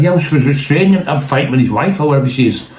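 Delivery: to the cops grabbing him, outraged protest; indignant protest. Retold or imitated. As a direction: insist you're innocent, indignant. Arguing with the arresting cops.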